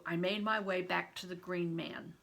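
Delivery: ordinary delivery, nothing marked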